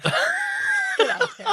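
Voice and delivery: high, drawn-out words through laughter; helpless laughter. Real, not acted. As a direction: squeal it out while cracking up. Cracking up at crude joke.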